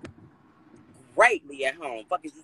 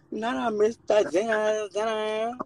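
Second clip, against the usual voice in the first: dismayed; 7 semitones higher, 5 dB quieter, slower than usual. Real, not acted. Dismayed at missing dinner.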